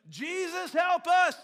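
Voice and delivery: high, loud shout of the name; panicked cry. Retold or imitated. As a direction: cry out for help in terror. Retold cry as storm hits.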